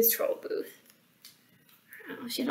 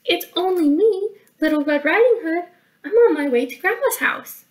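put-on high, loud little girl voice; 7 semitones higher, 4 dB louder than usual. Little Red answering the troll, high innocent voice. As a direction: chirp it high as an innocent child.

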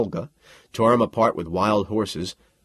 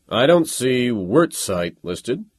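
slow, louder doubtful challenge; sceptical officer; 3 semitones higher, 5 dB louder, slower than usual. A narrator's reading. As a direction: draw it out, unconvinced. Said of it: "Major Casals said".

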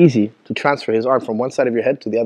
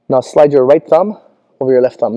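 normal talking voice

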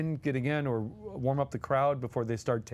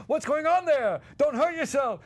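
much higher, loud teasing call; shouting from trailer; 11 semitones higher, 4 dB louder than usual. Retold or imitated. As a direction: call out across the set. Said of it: "Dom would always shout".

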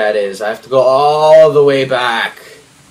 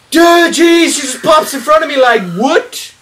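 real fright, high and loud, gasping; startled gasp; 12 semitones higher, 6 dB louder than usual. Real, not acted. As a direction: jumps out of her skin at the monster. Monster suddenly pops up in game.

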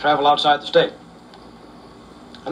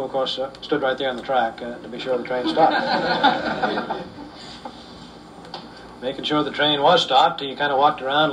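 ordinary talk, nothing marked

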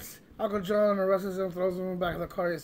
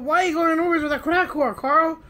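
quick, loud, higher-pitched disbelief; astonished and raised; incredulous question; 7 semitones higher, 8 dB louder than usual. Retold or imitated. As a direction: fire off a baffled question at a friend. Baffled question to friend.

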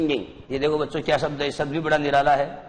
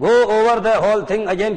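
commanding order; 6 semitones higher, 5 dB louder, much slower than usual. Acted. Teacher reads leader's command again.

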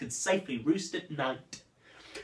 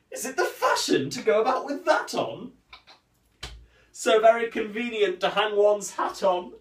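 higher, sneering put-down; contemptuous; 6 semitones higher than usual. Acted. Cyrano mocks the nose with a higher, sneering tone. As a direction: look down the nose at him. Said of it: "the more contemptuous tone".

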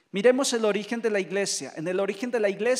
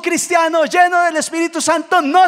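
full-force, high-pitched exhortation; preaching shout; 8 semitones higher, 14 dB louder than usual. Real, not acted. Preacher rallying brothers at the climax, full-force shout. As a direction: shout it out to rouse the whole congregation.

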